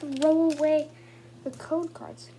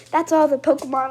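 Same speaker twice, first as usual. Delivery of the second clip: ordinary talk, nothing marked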